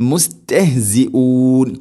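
"മുസ്തഹ്സിഊൻ" chanted slowly as musteh-, long ending; light t recitation. Shown as a demonstration. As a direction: keep "ത" light in "മുസ്തഹ്സിഊൻ", stretch the end. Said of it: "അതിനെ വളരെ ലൈറ്റായിട്ട്". ("മുസ്തഹ്സിഊൻ" ത ലഘുവാക്കി നീട്ടി ഓതുക)

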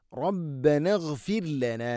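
slow chant with a pause after റബ്ബന; slow recitation. Shown as a demonstration. Teacher reciting the verse, slow chant. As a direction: chant റബ്ബന then ഇഗ്ഫിർ ലന. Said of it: "ശേഷം ഇഗ്ഫിർ ലന".

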